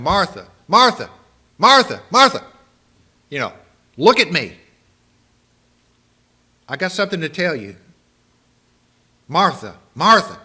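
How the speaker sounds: loud, high calling of her name; calling for attention. Retold or imitated. Jesus gets distracted Martha's attention, calling loudly. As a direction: call her name until she looks up. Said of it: "he's going".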